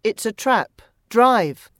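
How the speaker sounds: urgent shout. A narrator's reading. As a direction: call out the danger, punching the last word. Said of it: "he shouted".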